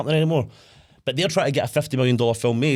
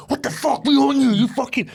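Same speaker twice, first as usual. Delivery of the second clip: much higher, louder mock rage; mock furious outburst; 12 semitones higher, 4 dB louder than usual. Retelling the gangster's son yelling, hammed-up fury. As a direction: ham up the furious reply. Retold angry outburst in story.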